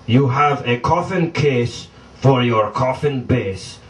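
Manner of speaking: normal talking voice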